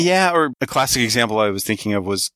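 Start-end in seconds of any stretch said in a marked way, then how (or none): none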